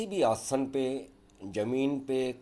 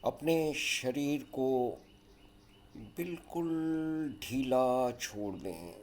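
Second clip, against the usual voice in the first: guided yoga nidra; 5 semitones lower, 8 dB quieter than usual. Acted. Leads a yoga nidra relaxation.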